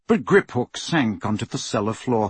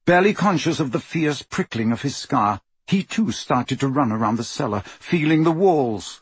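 plain reading voice

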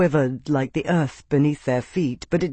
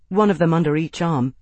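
ordinary delivery

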